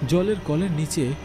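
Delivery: plain reading voice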